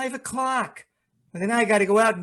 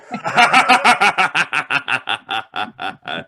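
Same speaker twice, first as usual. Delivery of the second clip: a louder, mocking laugh from nowhere; eerie laugh; 5 semitones lower, 7 dB louder than usual. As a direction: laugh like an unseen phantom. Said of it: "Who laughed like that?".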